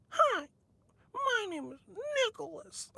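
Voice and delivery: high falsetto introduction; squeaky media voice. Retold or imitated. Imitating a media guy approaching a girl, squeaky falsetto. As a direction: introduce yourself in a tiny mousy squeak. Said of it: "with that voice and shit".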